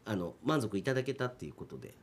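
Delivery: usual speaking voice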